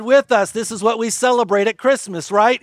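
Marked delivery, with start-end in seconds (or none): none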